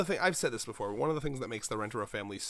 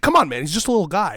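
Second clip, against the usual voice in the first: loud, higher, coaxing defense; mock pleading; 6 semitones higher, 14 dB louder, faster than usual. Cashier defending the robber, mock pleading. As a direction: plead for the little guy. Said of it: "he's like".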